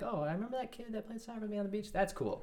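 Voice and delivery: put-on high, fast, bright kid voice; quick high-pitched child impression; imitating a kid. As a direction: chirp it like a young kid reminiscing. Imagined grown-up kid remembering him.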